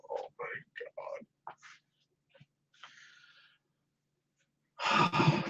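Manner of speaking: hushed, stunned oh my God; whisper. Real, not acted. Fan whispering in disbelief over injury news. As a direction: breathe it out in shock.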